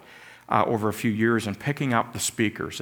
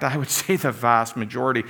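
ordinary talk

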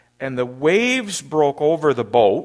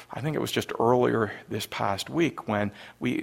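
ordinary talk, nothing marked

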